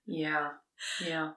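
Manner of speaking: earnest mock therapist advice. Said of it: "Your therapist says so".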